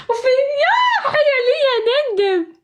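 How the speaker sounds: high, slow laughing wail; laughing lament. Real, not acted. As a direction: moan in mock regret while laughing. Laughing lament over losing. (ندب ضاحك بصوت عالٍ)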